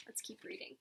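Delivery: breathy aside, under the breath; whisper. Acted. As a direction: whisper it so no one hears. Whispered aside while reading.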